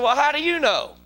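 high-pitched retold challenge; challenging tour guide. Tour guide challenging the pastor who contradicted him, retold challenge.

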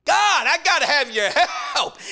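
loud, slow pleading shout; desperate cry to God. Acted. Elijah crying out to God for help, loud pleading shout.

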